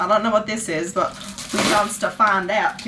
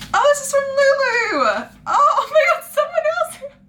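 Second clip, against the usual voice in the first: much higher, louder, half-crying squeal; thrilled squeal; 3 dB louder than usual. Real, not acted. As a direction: overcome by a gift from Lulu. Touched by a friend's gift.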